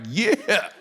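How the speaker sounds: drawn-out, high-pitched self-congratulating yeah; triumphant. Retold or imitated. Comedian reliving two standing ovations, triumphant. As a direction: crow it like you just won. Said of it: "I'm the man".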